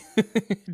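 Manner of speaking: laughing. Real, not acted. Laughing at the headline.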